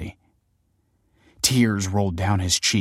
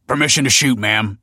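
raised, higher officer's call; 4 semitones higher, 6 dB louder than usual. A narrator's reading. Officer to superior as police face a bomber, called out. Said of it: "called an officer".

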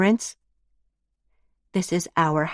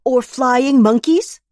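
indignant; 8 semitones higher, 7 dB louder than usual. Acted. Indignant follow-up question.

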